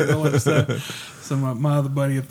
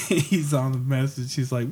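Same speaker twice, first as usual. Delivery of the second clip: plain reading voice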